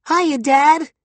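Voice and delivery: high squeak, a tiny talking dick; squeaky voice. A narrator's reading. Durden voicing his dick to the audience, high squeak. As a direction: squeak it up high like a cartoon critter. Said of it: "he squeaked".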